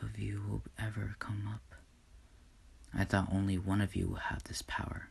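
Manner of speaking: soft, hushed, mostly whispered father; grieving whisper. Acted. God holding his two children after Inko dies, whispered. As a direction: murmur it to the babies in sorrow.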